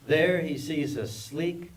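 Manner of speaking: usual speaking voice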